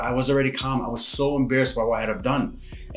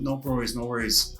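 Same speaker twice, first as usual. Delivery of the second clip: quiet humble reply; 8 dB quieter than usual. Retold or imitated. Humbly accepting forgiveness.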